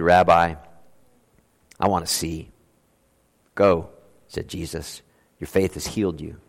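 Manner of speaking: measured, quiet Bible reading voice; scripture reading. Acted. Pastor reading the Bible passage to the congregation, measured reading.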